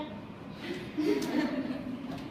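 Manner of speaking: breathy chuckle after the joke; quiet laugh at her own quip; soft laugh. Real, not acted.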